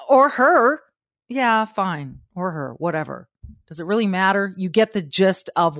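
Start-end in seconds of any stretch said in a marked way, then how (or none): 0.1-0.8 mock correction. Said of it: "you could choose to say".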